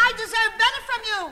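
loud, high, rapid protest; indignant shout. Retold or imitated. Wife standing up to husband in restaurant, shouting indignantly. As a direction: fire it at him loudly and fast.

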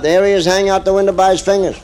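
ordinary delivery

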